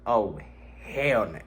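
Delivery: ordinary talk, nothing marked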